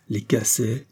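plain reading voice

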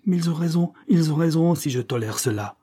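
bitter, emphatic self-reproach; irritated. A narrator's reading. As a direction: stew with rising resentment, easing at the end. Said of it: "pensa avec mécontentement son mari irrité".

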